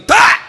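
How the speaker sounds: a sudden very high, very loud exclamation; startled mock scream; sharp shout. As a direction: burst out with a piercing cry. Outburst at Gabriel.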